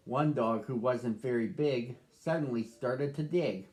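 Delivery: calm first-person storybook reading as the dog Duke; story narration. A narrator's reading. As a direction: read it gently to children, as the dog telling his own tale.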